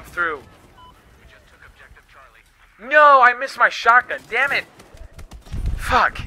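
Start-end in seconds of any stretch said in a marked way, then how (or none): 2.8-6.2 higher, louder cry of annoyance; frustrated outburst. Real, not acted. Player missed the shotgun kill and shouts in frustration. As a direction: curse the missed shot.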